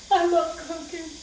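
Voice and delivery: high, laughing through the words. Real, not acted. Laughing off onion tears to viewers, high giggling. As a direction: giggle it out at yourself.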